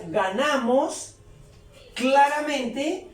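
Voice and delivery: slow, drawn-out words with rising ends; slow translation. Shown as a demonstration. Teacher translating for learners, slowly word by word. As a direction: stretch each word for learners.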